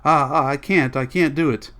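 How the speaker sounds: wild, anguished outcry; screaming. A narrator's reading. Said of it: "began to scream aloud".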